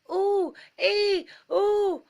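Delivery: very high, loud squealing rabbits; shrill panicked squeals of ooh and ee; terrified squeals as a crowd flees; frightened squeal. Acted. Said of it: "squealed the frightened rabbits".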